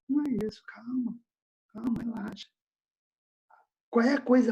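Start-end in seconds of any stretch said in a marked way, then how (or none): 0.1-2.5 much softer, calming reassurance; hushed, easy reassurance; soothing. Acted. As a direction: gently talk them down. Soothing an imagined worried objection.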